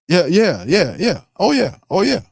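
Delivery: high, sing-song mock agreement; sarcastic yeah. Real, not acted. Host mocking the coach's apology, high and sarcastic.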